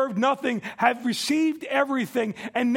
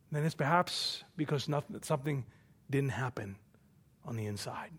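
hushed; 7 semitones lower, 7 dB quieter than usual. Real, not acted. Preacher drops to an intimate hush.

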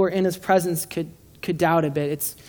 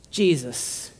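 usual speaking voice

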